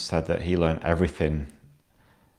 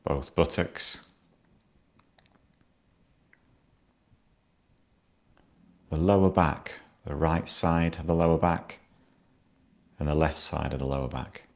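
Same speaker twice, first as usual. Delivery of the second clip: guided meditation. Acted. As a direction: ease the listener down into stillness. Guides a body scan.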